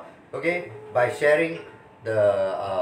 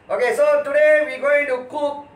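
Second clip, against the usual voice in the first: excited show host; 9 semitones higher, 4 dB louder than usual. Acted. Host restarting the show intro.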